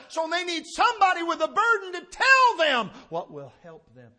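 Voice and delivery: building from strong to a loud, high peak; preaching build-up. Acted. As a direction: climb until you're shouting from the pulpit. Preaching climax about people's need.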